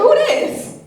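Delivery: sudden high, loud challenge; suspicious phone answer. Retold or imitated. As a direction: demand to know who is calling. Answers unknown number.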